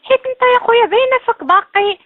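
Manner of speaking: angry. Real, not acted. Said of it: "مالك، مالك كتغوتي؟".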